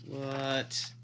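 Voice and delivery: ordinary talk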